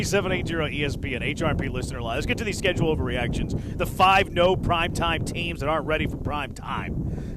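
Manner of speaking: normal talking voice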